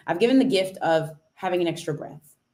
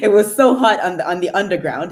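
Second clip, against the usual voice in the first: laughing; 6 dB louder than usual. Real, not acted. Laughing about the hot underground.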